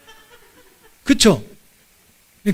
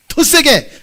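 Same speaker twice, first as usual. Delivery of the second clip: raised, high command; forceful coach-like call; urging them louder; 6 semitones higher, 5 dB louder, much slower than usual. Real, not acted. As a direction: spur the congregation on loudly. Urging congregation to shout harder.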